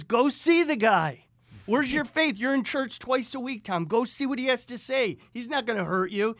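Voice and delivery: high, very loud imitation of a scolding father; screaming dad. Dad orders his hesitant son to go, screaming. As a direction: holler it like an old-time Italian screamer. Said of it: "And my dad screams at me".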